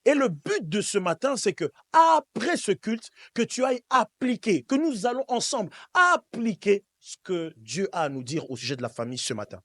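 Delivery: ordinary talk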